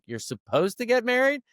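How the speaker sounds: high, rising disbelief imitating the staff; mock astonished question; incredulous question. Staff hearing about the wedding day, mock incredulous. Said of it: "And they're like".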